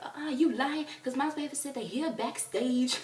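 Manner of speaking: high, loud disbelieving protest; messy challenge. Retold or imitated. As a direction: call out a liar, loud and high. Acted messy argument with fan.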